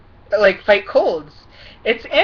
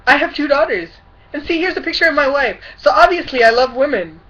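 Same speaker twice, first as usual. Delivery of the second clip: higher, louder mock apology; mocking Todd Atkins; 6 semitones higher, 6 dB louder than usual. Vlogger mimicking a politician's ridiculous apology, mocking. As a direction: push it up and out in ridicule. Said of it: "his apology was ridiculous".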